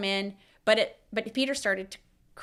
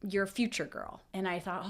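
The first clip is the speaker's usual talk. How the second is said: ordinary delivery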